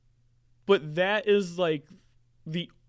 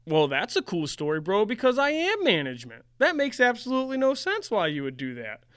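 higher and louder, exasperated disbelief; indignant rant; 5 semitones higher, 5 dB louder than usual. Real, not acted. Server venting at customers who threatened him with management, indignant and raised. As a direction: scoff at them, voice climbing in outrage. Ranting about the receipt note.

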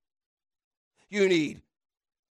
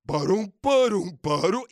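plodding sound effect; 4 semitones lower than usual. Acted. Imitating donkey train plodding.

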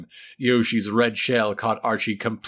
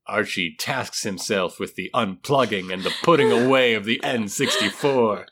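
usual speaking voice

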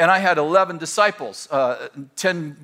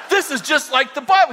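laughing retelling; 9 semitones higher, 4 dB louder than usual. Retold or imitated. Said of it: "And Danny goes".

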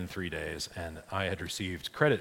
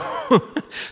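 short laugh; 14 semitones higher, 9 dB louder than usual. Real, not acted. Laughing at own story.

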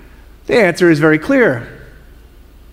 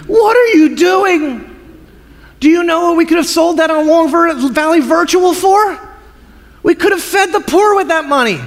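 mock disciples; 8 semitones higher than usual. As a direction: squawk at Jesus like indignant disciples. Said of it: "do exactly what Pastor John would do".

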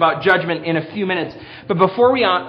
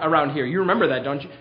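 ordinary talk, nothing marked

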